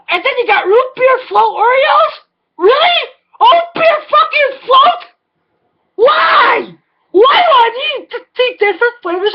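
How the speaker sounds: high, incredulous outrage at the snack aisle; ranting. Real, not acted. Comedian ranting to listeners about root beer float Oreos, outraged. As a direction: yell it like the world has gone mad. Rant about too many Oreo flavors.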